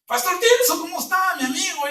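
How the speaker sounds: cheery greeting. Retold or imitated. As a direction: pitch it way up like an old friend's sing-song greeting.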